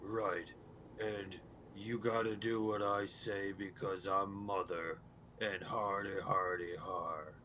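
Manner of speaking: low, gruff-captain impression; Mother. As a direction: imitate a low-voiced boss giving orders. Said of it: "He kinda sounds like this".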